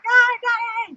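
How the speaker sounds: high excited cheer from a crowd of housemates. Retold or imitated. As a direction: squeal it like fans cheering him on. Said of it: "the housemates are like".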